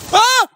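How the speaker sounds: sudden high shriek of fright; startled scream. Real, not acted. Scream when something startles the explorer.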